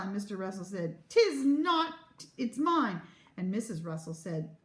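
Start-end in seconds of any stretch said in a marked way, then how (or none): 1.1-3.0 loud, high petulant retort; emphatic quarrelsome comeback; childish contradiction. A narrator's reading. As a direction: contradict like a squabbling kid. Parents quarrelling over towel.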